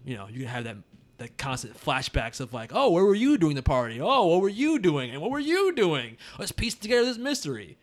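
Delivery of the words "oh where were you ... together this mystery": playful, higher-pitched mystery parody; mock whodunit questioning. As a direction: parody detectives questioning each suspect in turn.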